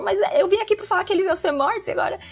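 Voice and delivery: high, fast, indignant Jonah imitation; whiny complaint. Retelling Jonah protesting to God, high fast mock indignation. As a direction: rattle it off like a sulking prophet.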